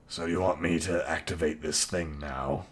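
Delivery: reluctant, low, quiet mutter; a grudging fighter, voiced low and soft; low grumbling voice. GM voices the grumpy Ronald.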